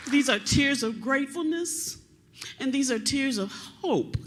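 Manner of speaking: fighting back happy tears; crying with joy. Real, not acted. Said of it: "I'm sorry, I am going to cry".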